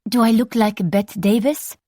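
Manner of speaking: a hint of a playful thirteen-year-old; lightly voiced girl. A narrator's reading. Cousin Rose smoking in a tree, lightly playful.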